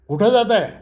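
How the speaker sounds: high, loud startled demand; alarmed shout. Retold or imitated. Old owner panicking as they walk off, loud alarmed shout. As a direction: call out in sudden alarm. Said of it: "म्हाताऱ्याला पेच पडला".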